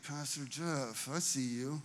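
low and loaded, a silent accusation; stink-eye greeting. Retold or imitated. Youth pastor passing the senior pastor in the hallway, pointed quiet stare. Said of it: "giving him the stink eye".

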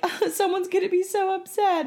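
high, worried sing-song; fretful worrying. Retold or imitated. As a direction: fret like a guilty girl. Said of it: "And I'm like".

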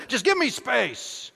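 overloaded husband snapping back, leave me alone; snapping at wife. Retold or imitated. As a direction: push her away with rising irritation. I'm like.

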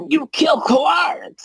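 very high, slow goblin shout; shrieking goblin. Acted. Red goblin accuses the killer, high shouted goblin voice.

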